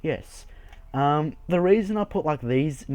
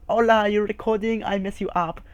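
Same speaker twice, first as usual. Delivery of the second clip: higher and louder mock Spanish accent; a vague put-on Spanish-ish accent; hammed-up Spanish-sounding accent from a heckler; 7 semitones higher, 4 dB louder than usual. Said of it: "I don't know what accent I'm going for".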